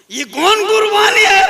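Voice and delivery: loud, high preaching cry; outraged shout. Acted. Preacher demanding of the congregation what sacrifice this is, shouted. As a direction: fling the question at the crowd.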